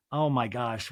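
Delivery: ordinary talk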